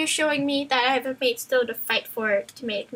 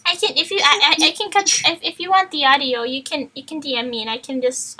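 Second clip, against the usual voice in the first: usual speaking voice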